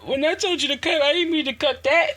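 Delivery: high-pitched mock dismay; shocked objection. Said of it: "then you be like".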